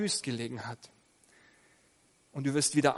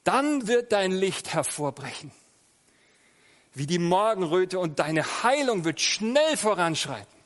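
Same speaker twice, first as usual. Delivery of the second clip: solemn, louder reading of the Bible verse; scripture reading; 2 semitones higher, 5 dB louder than usual. Acted. Preacher reads the finale to the congregation, solemn and louder. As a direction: proclaim the promise to the congregation. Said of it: "das lese ich euch jetzt mal vor".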